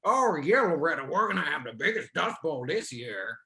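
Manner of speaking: loud opening, hammed-up character reply; mock Kansas local. Kansas local answering Loretta about storms, hammed-up character voice. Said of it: "everyone near either talks like".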